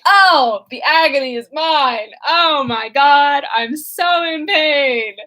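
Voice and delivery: loud, slow melodramatic wail; hammed-up agony. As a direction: overact grief like a bad stage hero. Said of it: "he's like".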